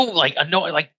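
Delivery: normal talking voice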